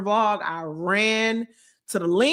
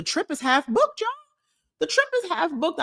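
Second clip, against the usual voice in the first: much higher, thrilled announcement; excited; 11 semitones higher than usual. Real, not acted. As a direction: burst out with the news. Excited news repeated.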